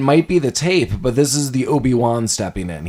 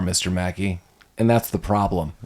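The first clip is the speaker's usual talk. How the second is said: quiet putdown; 6 semitones lower, 6 dB quieter than usual. Retold or imitated. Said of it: "And he's like".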